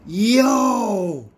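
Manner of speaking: high, loud, drawn-out yo; real excited yell. Real, not acted. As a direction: shout it out in pure delight. Reacting to a rare pull.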